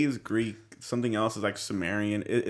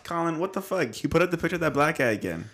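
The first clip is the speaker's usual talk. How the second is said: higher, exasperated scolding; mock scolding; 8 semitones higher, 4 dB louder than usual. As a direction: play the fed-up host. Scolding cohost over a picture.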